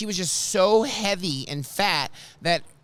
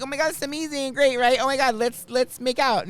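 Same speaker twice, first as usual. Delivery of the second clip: mock gushing fan; 7 semitones higher than usual. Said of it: "I became you, and I tried to be that person".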